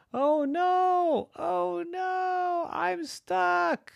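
very high, drawn-out wail of alarm; high-pitched storybook panic; panicked cry. Acted. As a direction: cry out as a trapped child. Boy trapped in a deep hole.

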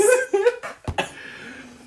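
very high-pitched fit of laughter; helpless high giggling; laughing. Real, not acted. Friend after a crude joke, laughing.

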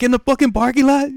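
loud, high incredulous question; retold shock. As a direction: act out disbelief on the phone. Retold shocked reply to caller.

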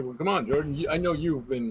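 ordinary delivery

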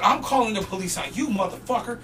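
unshouted comeback in near-normal voice; retold retort. Worker answering the punched bum, controlled retort. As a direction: turn the threat back on him without screaming.

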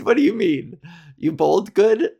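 usual speaking voice

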